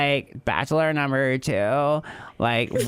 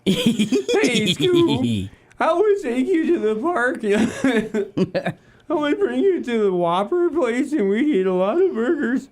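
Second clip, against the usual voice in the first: high, louder cartoon slacker voice; Shaggy voice; 11 semitones higher, 3 dB louder than usual. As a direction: go up high like Shaggy talking to Scoob. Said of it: "my voice is way too deep right now for that".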